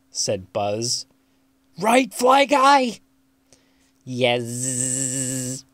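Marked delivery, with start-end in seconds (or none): 1.8-3.0 high, loud excited question; high-pitched, eager kid's shout; eager boy. A narrator's reading. Boy calls to his pet fly. 4.0-5.6 level drone with a long buzzed ending; monotone insect buzz; buzzing fly voice. A narrator's reading. Pet fly answers its boy.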